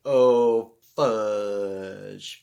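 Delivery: loud, dismayed oh fudge; kid's dismayed oh fudge; mock dismay. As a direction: blurt the curse like a kid caught out. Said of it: "he's like".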